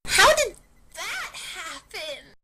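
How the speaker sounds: put-on astonished girl. [name] astonished.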